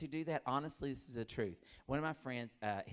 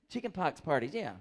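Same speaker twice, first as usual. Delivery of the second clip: ordinary delivery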